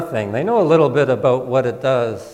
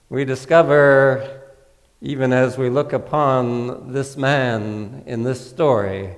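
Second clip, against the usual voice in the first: ordinary delivery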